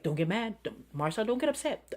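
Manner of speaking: softly, talking herself down; quiet self-talk. Shown as a demonstration. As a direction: murmur it to yourself to stay calm. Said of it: "and I say to myself".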